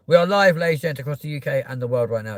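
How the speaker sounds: loud, high host announcement; show host intro. Acted. Opens the live show.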